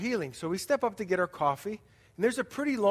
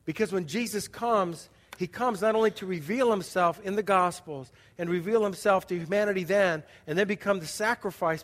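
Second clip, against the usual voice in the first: normal talking voice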